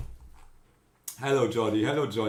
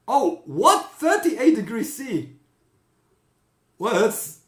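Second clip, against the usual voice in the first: much higher and louder astonished cry; real shock at the heat; shocked disbelief; 9 semitones higher, 6 dB louder than usual. Real, not acted. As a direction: blurt it out, stunned by the number. Reacts to hot weather report.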